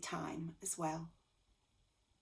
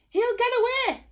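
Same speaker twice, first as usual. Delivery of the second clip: alarmed thought; 11 dB louder than usual. A narrator's reading. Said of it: "thought Jack".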